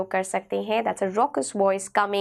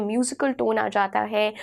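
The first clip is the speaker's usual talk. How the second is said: usual speaking voice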